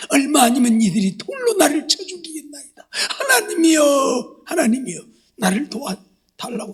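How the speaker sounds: acted anguished cry for help; desperate crying out. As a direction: cry out in urgent prayer, stretching the name. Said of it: "하나님을 향하여 소리쳐 부르짖어".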